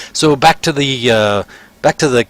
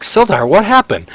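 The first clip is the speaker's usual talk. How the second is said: higher, urgent question; raised worried voice; alarmed shopkeeper; 8 semitones higher than usual. Acted. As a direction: demand to know what happened. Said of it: "he's like".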